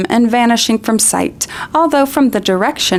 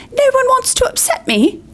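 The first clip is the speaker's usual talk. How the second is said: high, bitter howl. Acted. Myrtle howls back at Hermione, bitter. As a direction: howl it out in scorn. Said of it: "howled Myrtle".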